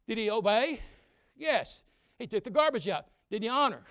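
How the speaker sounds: loud, high preacher's shout; full-force sermon voice; fiery preaching. Acted. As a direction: preach it hard to the young people.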